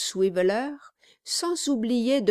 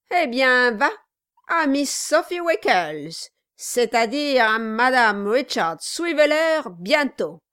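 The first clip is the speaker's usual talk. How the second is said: loud, higher, rousing delivery; boisterous toast; 3 semitones higher, 5 dB louder than usual. A narrator's reading. Dwarf toasting his guest's bride-to-be, boisterously excited. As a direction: proclaim it with teasing gusto.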